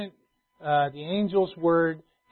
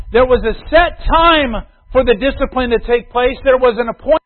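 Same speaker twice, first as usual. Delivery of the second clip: preaching at full force; 7 semitones higher, 11 dB louder than usual. Acted. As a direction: build to a thundering point. Preaching climax.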